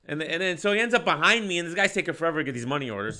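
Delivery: ordinary delivery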